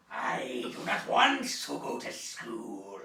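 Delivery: "I do not want to go to school" very loud and slow; exaggerated enunciation. Shown as a demonstration. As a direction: belt out each word of "I do not want to go to school", stretched. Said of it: "Try to get your whole face in there".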